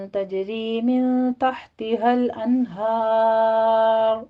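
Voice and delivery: slow, melodic chanted recitation; Quran recitation. Acted. Teacher reciting the verse to the class, slow melodic chant. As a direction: chant the verse slowly with long held vowels.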